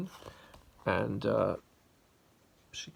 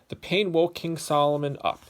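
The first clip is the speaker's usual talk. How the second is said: louder, animated storybook telling; lively story narration; 3 semitones higher, 7 dB louder than usual. A narrator's reading. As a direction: tell it big for the kids. Reads story to children.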